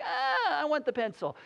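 a hint of a whiny little girl; mild child whine. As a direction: whimper it lightly. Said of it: "this whine on her voice".

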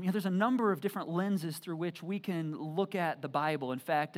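usual speaking voice

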